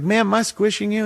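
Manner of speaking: high, sweet mock-polite ask. Asking the old lady beside him, high and sweet. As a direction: lift it up and sweeten it. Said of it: "And I was like".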